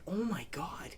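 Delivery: soft, awed murmur; hushed awe. Retold or imitated. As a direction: breathe it out in quiet amazement. Watching his own finger operation.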